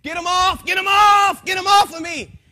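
high, loud panicked shouts; retold terrified screaming. Man fighting demons on waking, terrified screams. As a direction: yell to get them off you. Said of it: "I was screaming".

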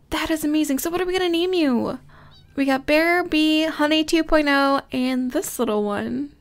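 ordinary talk, nothing marked

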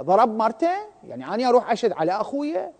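ordinary delivery

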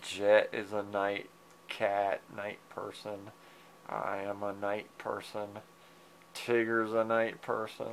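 hushed late-night talk; quiet. Real, not acted. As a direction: murmur it low to the sleepy cat. Sleepy talk to cat.

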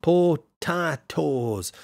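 very slow Irish "potatoes", syllable by syllable; attempted Irish accent. Shown as a demonstration. Streamer playing with the word, hammed-up Irish accent. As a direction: stretch each syllable in a hammed-up Irish brogue. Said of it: "say that in the Irish accent".

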